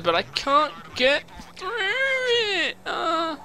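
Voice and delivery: high, slow, tearful yell; crying shout. Real, not acted. Player stuck on the road, crying shout. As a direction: wail it out in despair.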